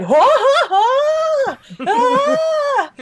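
very high comic hoots; whooping, ape-like squeals; mock excited shrieks. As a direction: imitate grandma hooting at a screen. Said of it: "shouting things at the computer".

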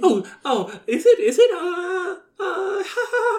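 high helpless laughing; real laughter. Real, not acted. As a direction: lose it, laughing. Breaks up laughing.

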